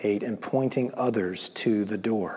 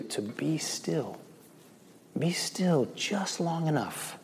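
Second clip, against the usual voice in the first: calm and still; 4 semitones higher, 8 dB quieter, slower than usual. Acted. Urging stillness.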